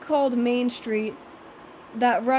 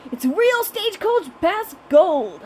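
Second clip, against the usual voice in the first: slow, hammed-up Southern drawl, higher and louder; cheesy southern accent; 6 semitones higher, 4 dB louder than usual. A narrator's reading. Bored park worker reciting his line in a cheesy Southern accent. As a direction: ham up a Southern theme-park twang. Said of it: "with a cheesy southern accent".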